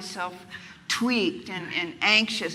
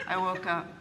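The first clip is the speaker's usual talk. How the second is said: usual speaking voice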